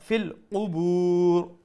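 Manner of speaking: ফিল কুবুর with a long drawn u; slow recitation holding the বু of কুবুর; long madd at stop. Shown as a demonstration. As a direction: stretch the last vowel, then stop. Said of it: "এন্ডে দি মধ্যে".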